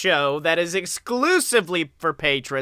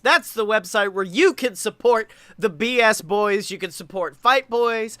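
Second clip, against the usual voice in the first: ordinary talk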